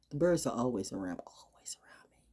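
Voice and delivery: soft voice fading into a whisper; hushed whisper. Real, not acted. Speaker noticing birds chirping nearby, hushed whisper. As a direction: drop to a whisper for the echo.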